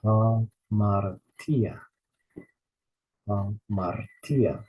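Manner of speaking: teacher modelling the rough breathing in Hamartia; slow pronunciation. Shown as a demonstration. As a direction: stretch Hamartia syllable by syllable, breathing the h. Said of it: "we add a sound at the beginning".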